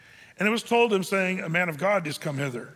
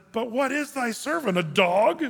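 mock indignation; 6 semitones higher, 4 dB louder than usual. Reads Hazael's offended question.

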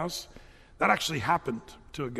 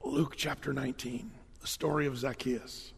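quieter, lower hushed voice; whispered assignment; 5 semitones lower, 7 dB quieter than usual. Retold or imitated. Spurgeon whispering the text to a student, hushed. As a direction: murmur it into his ear. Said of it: "he whispered to this young dude".

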